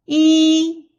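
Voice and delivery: "Yi" high, loud and level; first tone. Shown as a demonstration. Teacher leads repeat drill, high level yi. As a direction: hold "Yi" on one high note. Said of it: "The first tone is the highest".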